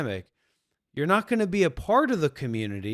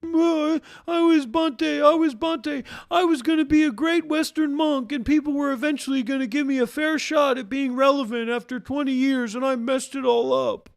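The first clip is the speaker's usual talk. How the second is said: much higher, whiny self-pitying voice; mock self-pity; 10 semitones higher, 3 dB louder than usual. Mocking Bhante's self-pity, high whining voice. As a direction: whine like a sorry ex-monk.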